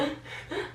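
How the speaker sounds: high, soft sobbing gasps; imitated hysterical sob. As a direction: mimic gasping through hysterical sobs.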